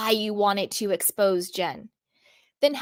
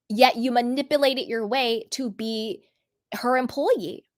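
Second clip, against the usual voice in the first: ordinary talk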